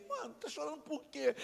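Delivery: high, soft mocking voice; imitated jeering query; taunting question. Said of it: "Aí ele falou assim".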